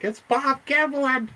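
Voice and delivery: high, drawn-out fanboy squeal; starstruck. Retold or imitated. Fan spotting his Bigfoot hero, starstruck squeal. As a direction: squeal the name slowly in awe.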